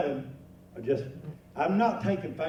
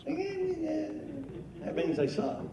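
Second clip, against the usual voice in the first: high, very slow, soft mocking drawl; deadpan jab; 11 semitones higher, 5 dB quieter, much slower than usual. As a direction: stretch it out slow as a teasing put-down. Punchline about Gophers.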